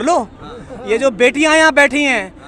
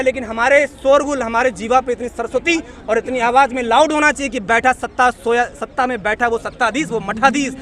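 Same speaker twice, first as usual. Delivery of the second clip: ordinary talk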